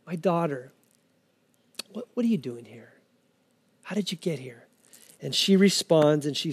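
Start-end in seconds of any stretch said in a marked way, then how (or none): 0.0-4.7 soft, lowered, gentle questioning; tender, fatherly. Acted. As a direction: ask softly, as to a daughter, with pauses. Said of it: "it's in a tender voice".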